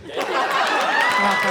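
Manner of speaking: very high, slow, drawn-out you're welcome; mock grand thank-you. Comic to the audience, mock grand. Mock gracious handing over gift.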